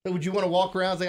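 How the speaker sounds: usual speaking voice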